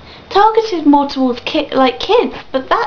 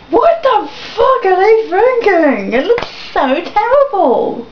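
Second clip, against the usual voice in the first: high, drawn-out incredulous complaint; exasperated outrage; 5 semitones higher than usual. Real, not acted. Fan ranting at the game's makers, exasperated. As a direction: stretch out the outrage, pitched high.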